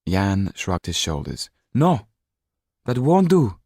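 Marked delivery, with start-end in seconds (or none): 1.7-3.6 sharp, louder, higher rebuke. Acted. Mrs Laxton rebukes Jan's shrug, sharp and loud. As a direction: cut him off sharply. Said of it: "she said sharply".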